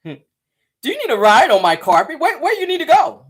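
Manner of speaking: high, loud hammed-up carpet hustler; mock genie driver. Gossip host mocking a reality star as a cab-carpet genie. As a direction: offer the carpet ride like a sly cab driver. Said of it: "He look like he wanna ask somebody".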